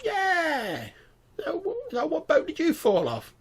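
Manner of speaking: very high, incredulous goblin voice; scoffing goblin. Acted. Goblin amazed the stranger hasn't heard of the wedding, scoffing.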